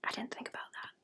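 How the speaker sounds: hushed startled whisper. Real, not acted. Remembering the month ends Friday, whispered to herself. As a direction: realise it under your breath. Realises date aside.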